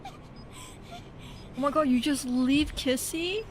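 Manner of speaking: high, slow, wailing oh my god; a drawn-out, crying wail; anguished cry. Real, not acted. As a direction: cry out in real dismay. Kissy left behind in the case.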